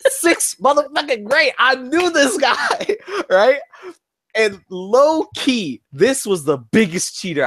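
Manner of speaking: high, slow, laughing emphasis; emphatic excitement. Real, not acted. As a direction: stretch it out, hyped and incredulous. Emphatic build-up to cheating story.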